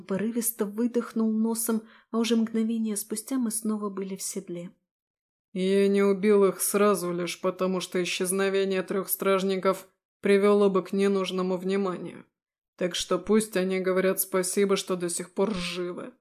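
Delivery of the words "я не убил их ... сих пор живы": cold, menacing demon voice; simmering threat; angry demon. Acted.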